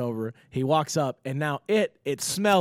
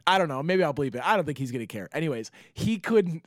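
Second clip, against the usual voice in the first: normal talking voice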